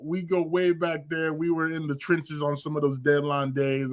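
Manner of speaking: usual speaking voice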